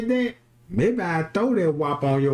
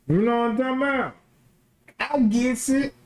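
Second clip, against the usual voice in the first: ordinary talk, nothing marked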